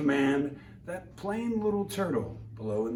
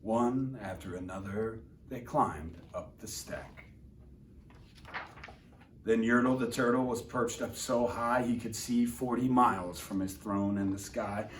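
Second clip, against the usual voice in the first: plain reading voice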